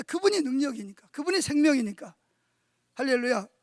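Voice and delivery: loud, rising sermon call to the congregation; fiery pulpit preaching; preaching at full force. Real, not acted. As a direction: drive each point home to the church.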